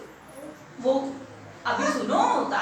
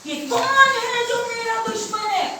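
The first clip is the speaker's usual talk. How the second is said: lion's challenge; 7 semitones higher, 4 dB louder than usual. Acted. Lion challenges his enemy in the well, demanding. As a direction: call down to the enemy, pitch held high.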